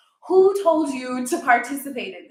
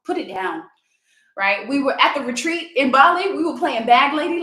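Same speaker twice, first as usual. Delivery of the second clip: ordinary delivery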